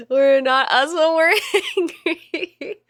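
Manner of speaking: laughing through the line. Real, not acted. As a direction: giggle out the quote. Quoting ad while laughing.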